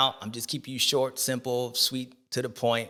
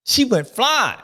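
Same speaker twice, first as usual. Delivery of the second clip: ordinary talk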